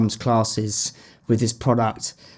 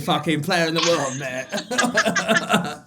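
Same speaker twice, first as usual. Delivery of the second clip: fast, higher-pitched mock brag; cocky founder boast; 4 semitones higher than usual. Founder five years in bragging to a mate, mock-cocky. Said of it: "Where you're like".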